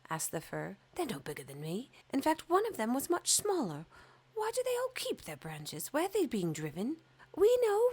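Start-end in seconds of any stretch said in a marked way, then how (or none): 0.9-7.0 rising higher in indignant wonder; puzzled and a bit jealous; fir tree voice. Acted. Fir tree complaining that smaller trees were chosen, rising higher. 7.4-7.9 very high and loud chirp; twittering sparrows. Acted. Grey sparrows twittering to the fir, very high and loud.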